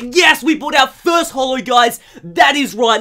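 high, loud triumphant cheer; thrilled, punched-out victory yell; excited shout. Real, not acted. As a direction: shout it out in celebration. Celebrates pulling first holo card.

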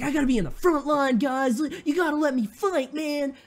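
high-pitched, hammed-up pleading hero; mock whine, pitched well above normal; Danny Rand impression. Fan imitating Danny begging his team to let him fight, hammed-up plea. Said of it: "that's what Danny Rand sounds like".

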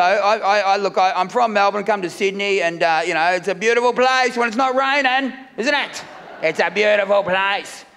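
ordinary talk

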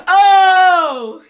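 loud high mock yelp of pain; imitating a whiny yelp; mock pained cry. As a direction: squeal like a stubbed toe, hammed up. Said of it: "He's like".

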